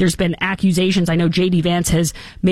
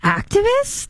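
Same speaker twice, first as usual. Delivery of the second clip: much higher, rising outraged disbelief; incredulous; slower than usual. Retold or imitated. Host mocking the media label for protesters, incredulous high rise. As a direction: squeak the word Activists? in disbelief. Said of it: "I was like".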